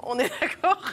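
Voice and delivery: amused, chuckling; laughing. Real, not acted. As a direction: agree through a laugh. Laughing agreement.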